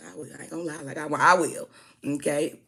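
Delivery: ordinary delivery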